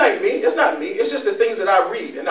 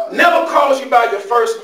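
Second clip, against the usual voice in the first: ordinary talk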